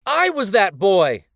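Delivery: loud, high cry from the crowd; a crowd member cries out loud. A narrator's reading. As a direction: call it out proudly. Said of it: "a loud voice cried out".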